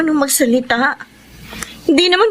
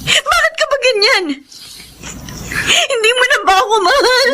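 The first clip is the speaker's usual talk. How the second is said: tearful pleading; 9 semitones higher than usual. Acted. Wife pleads with cruel husband.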